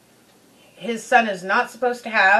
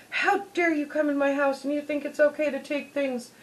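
normal talking voice

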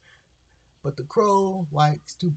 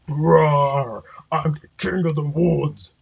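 roaring bear; slower than usual. A narrator's reading. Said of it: "roared the bear".

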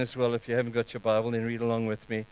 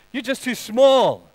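high, loud, drawn-out put-down; voice of shame; 8 semitones higher, 6 dB louder, slower than usual. Acted. Shame tells a believer they are worthless, belittling. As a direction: belittle them slowly and loudly. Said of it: "voice of shame will tell you".

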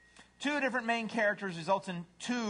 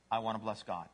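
low, understated devoted dog; humble dog; 6 semitones lower, 4 dB quieter, faster than usual. Acted. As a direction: answer low and simply, lightly voiced. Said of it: "What's a dog say?".

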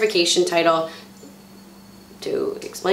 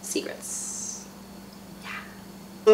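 breathy whispered aside; 11 dB quieter, much slower than usual. Real, not acted.

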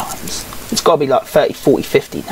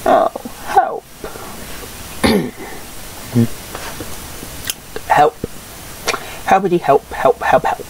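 mock begging; 5 dB quieter than usual. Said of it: "I'm actually gonna beg now".

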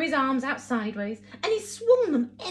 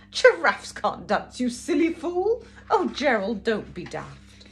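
mocking; 2 semitones higher, 5 dB louder than usual. Animals mocking Gerald.

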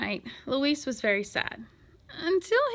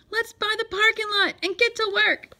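loud, high exclamation; excited cry; 10 semitones higher, 5 dB louder than usual. A narrator's reading. Librarian rallying the boy after finding treasure, excited cry. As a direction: call out the plan eagerly. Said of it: "cried Miss Severs".

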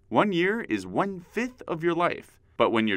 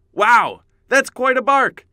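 impressed; 10 semitones higher, 9 dB louder, slower than usual. Acted. Impressed by barking listener.

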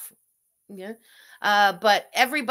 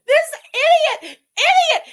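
high, loud laughing jeer; incredulous high-pitched mocking outburst; laughing derision; 12 dB louder than usual. Real, not acted. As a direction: screech it out high through laughter, drawn out. Mocking the man on stream.

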